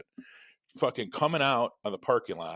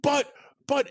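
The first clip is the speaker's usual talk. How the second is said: sputtering protest; 4 dB louder than usual. Retold or imitated. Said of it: "And he goes".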